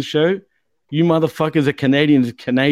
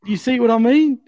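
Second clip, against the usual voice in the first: mock Australian accent; 8 semitones higher than usual. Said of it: "I fucking hate talking like this".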